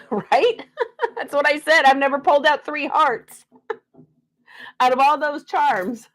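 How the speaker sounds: real laughter while talking; laughing. Real, not acted. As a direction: giggle it out, delighted and amazed. Laughing at three hearts drawn.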